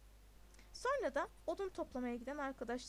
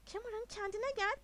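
higher-pitched, urgent reproof; scolding; 7 semitones higher, 3 dB louder than usual. A narrator's reading. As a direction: tell a friend off to steady him. Boy scolding a shocked friend.

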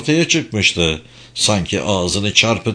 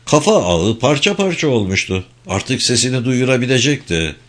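ordinary delivery, nothing marked

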